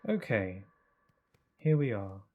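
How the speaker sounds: a mock-serene voice to prove he's calm; put-on calm voice. Gamer shows the chat his calm voice, slow mock serenity. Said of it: "This is what I sound like when I'm calm".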